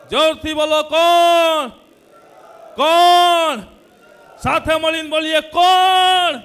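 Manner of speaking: very loud, high, drawn-out final word; rallying shout. Real, not acted. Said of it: "ઓર જોરથી બોલીએ".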